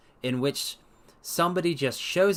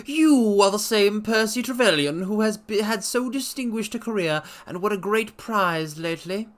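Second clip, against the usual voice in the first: higher, louder brusque stranger; a pushy visitor, pitched up; Blessington voiced; 7 semitones higher, 3 dB louder than usual. A narrator's reading. Blessington bursting in on the doctor, high brusque voice. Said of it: "said he".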